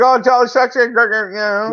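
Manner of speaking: high mock choirboy voice; prim goody-goody. Teasing a clean-living quarterback in a high goody voice. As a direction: squeak it out like a Sunday-school kid.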